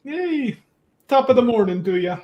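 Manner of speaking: hammed-up stage Irish accent, high and loud; loud mock Irish greeting. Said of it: "Hello, Irishman".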